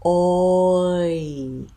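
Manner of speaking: Oi glided from rounded back to spread front; oi diphthong demo. Shown as a demonstration. Teacher showing learners the oi sound, drawn out. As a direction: model Oi slowly with lips rounding then spreading. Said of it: "ending with my lips spread".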